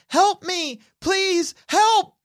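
mock scream for help, held in volume; child's cry for help. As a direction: plead high like a trapped kid. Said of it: "You can hear two sets of yelling".

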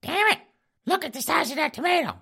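put-on high squeaky old lady griping; falsetto wife complaining. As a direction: whine it in a granny falsetto. Wife voiced complaining about tomato.

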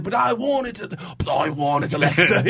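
teasing pirate voice for a wrestler; pirate-ish Cockney. Retold or imitated. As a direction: whine it like a Caribbean pirate. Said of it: "making her sound like bad guys in Pirates".

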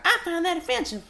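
very high southern-girl mimic; imitating an offended teenage fan; mock offended girl. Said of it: "A girl was like".

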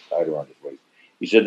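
usual speaking voice

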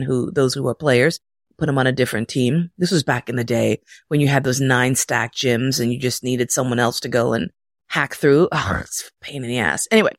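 plain reading voice

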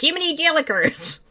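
put-on high, gee-whiz old-timey kid. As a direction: squeak it like a wholesome fifties kid. Old-fashioned exclamation.

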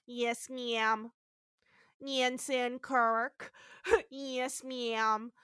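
slow, imitated Midwestern accent. Host mimics officer answering the lawyer, Midwestern accent. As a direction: put on a drawn-out Midwestern accent. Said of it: "has the best Midwestern accent".

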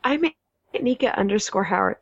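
hiccuping through the words; speaking with the hiccups. Real, not acted. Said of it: "I have the hiccups".

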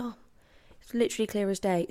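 ordinary talk, nothing marked

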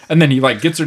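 usual speaking voice

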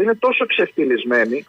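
usual speaking voice

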